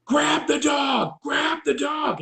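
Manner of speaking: high, drawn-out imitation of panicked yelling; retold screaming. Onlookers panicking as a dog handler leaves the box, retold screaming. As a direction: mimic a crowd screaming the order. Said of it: "everybody was screaming".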